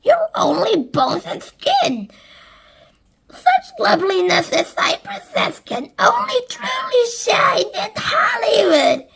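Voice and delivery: high, loud boastful gush; vain aunt Sponge. A narrator's reading. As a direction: gush over your own beauty. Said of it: "as Sponge cried out".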